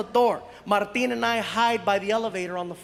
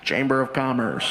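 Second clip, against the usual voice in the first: much lower, deadpan official voice; fake official voice; 11 semitones lower than usual. Pranksters at the hotel door, disguised low official voice.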